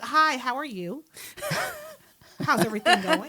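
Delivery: prim, proper office voice; polished and polite; polite corporate voice. Retold or imitated.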